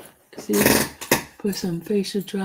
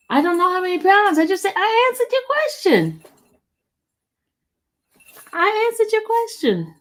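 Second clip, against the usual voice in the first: defensive; 10 semitones higher, 3 dB louder than usual. Real, not acted. Defensive retort to a live-chat viewer.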